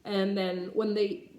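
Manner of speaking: ordinary delivery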